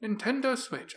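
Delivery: high-pitched, badly faked Japanese accent; terrible Japanese accent. Host announcing the console, botched Japanese accent. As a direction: attempt a Japanese accent and miss. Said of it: "That was a terrible Japanese accent".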